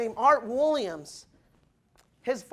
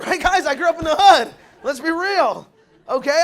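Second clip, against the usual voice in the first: much louder, laughing confession; loud laughing aside; 4 semitones higher, 9 dB louder than usual. Real, not acted. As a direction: shout it with a laugh.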